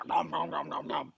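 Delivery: low, greedy chomping; munching noises. Acted. The sword eats the letter, low munching noises. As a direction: gobble it up in a low voice.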